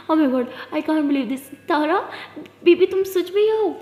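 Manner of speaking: slow, laughing disbelief at the reunion; overjoyed. Acted. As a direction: savor the surprise.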